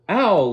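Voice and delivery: sharp cry of pain. Acted. Sound of slap.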